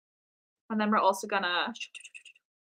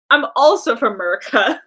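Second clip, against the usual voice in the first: louder, hammed-up American accent; put-on American accent; 2 semitones higher, 9 dB louder than usual. Artist tells viewers where they're from, put-on American accent. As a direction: ham up being American, accent and all. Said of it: "If you can't tell by my accent".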